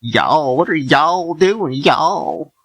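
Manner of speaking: high, drawn-out Southern twang; mock a twangy country-singer Southern accent; exaggerated Southern accent. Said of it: "exaggerate the Southern accent".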